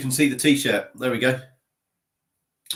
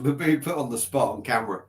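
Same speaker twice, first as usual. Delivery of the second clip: ordinary talk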